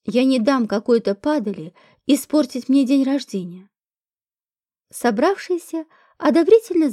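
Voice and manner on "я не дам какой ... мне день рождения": usual speaking voice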